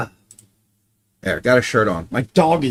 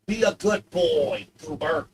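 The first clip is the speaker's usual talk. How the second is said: coaxing dog; 4 semitones higher, 4 dB quieter than usual. Real, not acted.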